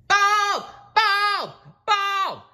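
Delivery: high, loud repeated shrieks; piercing cartoon screaming; screech. A narrator's reading. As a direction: screech each word as a separate blast. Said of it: "screeched SpongeBob".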